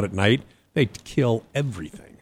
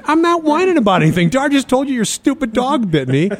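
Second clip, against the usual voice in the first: high, loud retold protest; indignant retort; 13 semitones higher, 4 dB louder than usual. Bitten man protests to the dog's owner, indignant retort. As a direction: re-enact an exasperated comeback at full pitch.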